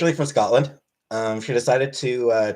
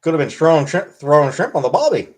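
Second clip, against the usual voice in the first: hammed-up Aussie, louder and a bit higher; Australian accent; 3 semitones higher, 4 dB louder than usual. Joking about mom choosing Australia over Canada, mock Australian accent. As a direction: toss the line like a cartoon Australian.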